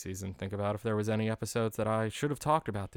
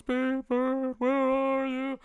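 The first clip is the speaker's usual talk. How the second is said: much higher, drawn-out echoing call; distant calling; much slower than usual. Retold or imitated. Neighbours searching for Big Bird, calling from far away.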